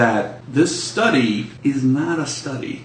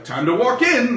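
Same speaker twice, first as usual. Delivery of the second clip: mock casual; 11 semitones higher than usual. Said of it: "like, go, like".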